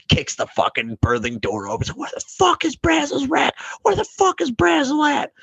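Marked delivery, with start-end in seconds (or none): 1.9-5.3 much higher put-on yell of a raging chief; mock furious chief. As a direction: storm in bellowing a name. Chief storming in yelling.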